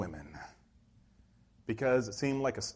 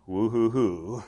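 limp woo-hoo; 3 semitones lower, slower than usual. Retold or imitated. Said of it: "like some of you guys".

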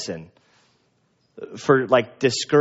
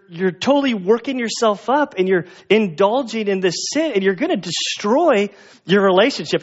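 scolding; 8 semitones higher than usual. Retold or imitated. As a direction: tell her off, half laughing. Preacher scolds upset woman.

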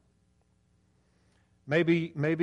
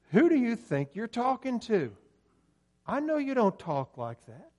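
higher, mock-indignant challenge; incredulous retort; 9 semitones higher than usual. Preacher's unspoken reply to his friend's put-on accent, incredulous. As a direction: call out his phony act in disbelief.

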